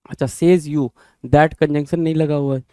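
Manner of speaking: usual speaking voice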